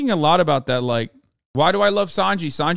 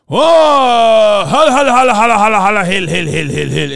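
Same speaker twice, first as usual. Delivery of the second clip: loud, higher hammed-up chant of a laugh; mock odd laugh; 5 semitones higher, 9 dB louder than usual. Mocking a character's laugh to viewers, loud hammed-up chant. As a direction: bellow a silly made-up laugh. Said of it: "that's not a laugh".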